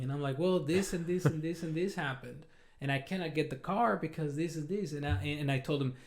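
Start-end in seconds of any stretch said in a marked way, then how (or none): none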